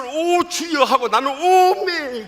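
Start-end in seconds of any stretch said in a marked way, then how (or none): 0.0-0.8 high, loud startled "오, 주여"; startled cry to the Lord. Retold or imitated. Deaconess thrown forward, crying out in fright. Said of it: "두 사람 소리가 동시에". 1.3-2.3 high, loud Jeolla "오메" through a laugh; startled "오메". Retold or imitated. Pastor thrown forward, startled, laughing at himself. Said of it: "두 사람 소리가 동시에".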